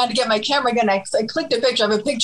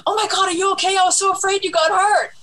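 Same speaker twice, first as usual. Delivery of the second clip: high, anxious concern; worried rock star; 9 semitones higher than usual. Retold or imitated. Musician rushing over in the lobby after the swerve, high worried voice. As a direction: fuss over her as if scared she's hurt. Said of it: "And he goes".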